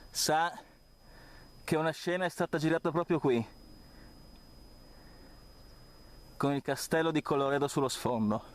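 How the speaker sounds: out of breath while pedalling uphill; breathless, talking between breaths. Real, not acted. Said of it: "Scusate il fiatone".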